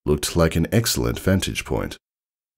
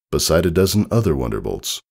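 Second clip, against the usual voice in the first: ordinary delivery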